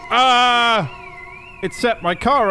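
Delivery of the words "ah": loud high yelp of fright; real scream at a car alarm; startled scream. Real, not acted. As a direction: jump and shout. Jump scare from car alarm.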